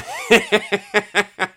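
loud, high cackle of laughter; high burst of laughter. Real, not acted. Laughs at own joke.

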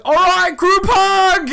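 very high, loud cheer; imitated celebratory shout. Teammate after a made shot, imitated loud cheer. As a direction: whoop it out to rally the team.

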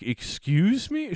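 slow stunned excuse me; high disbelieving question; shocked. Retold or imitated. Shocked retold reaction.

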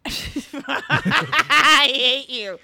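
slow, laughing through the words. Real, not acted. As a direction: laugh it out, drawn way out. Laughing after botched restarts.

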